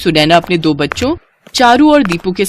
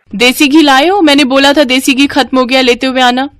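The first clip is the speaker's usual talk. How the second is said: demanding wife; 6 semitones higher than usual. Acted. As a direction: demand it sharply from your husband. Sunaina demands ghee from husband.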